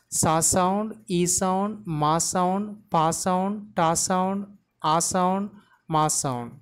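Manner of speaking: each sound of "symptom" named separately; sound-by-sound pronunciation. Shown as a demonstration. Teacher teaching learners to pronounce symptom, sound by sound. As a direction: break it up evenly: "Sa sound, e sound". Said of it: "The pronunciation of the word is".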